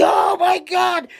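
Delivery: very high, drawn-out mock hysterics; hammed-up helpless hilarity; hysterical laughing. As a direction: squeal it slowly as if cracking up. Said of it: "laughing hysterically".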